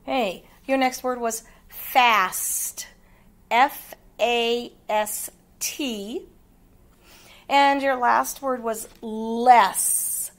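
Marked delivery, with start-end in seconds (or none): 3.5-6.3 slow letter names in "F A S T", each set apart; spelled out. Shown as a demonstration. Teacher checking pupils' work, "F A S T" spelled slowly.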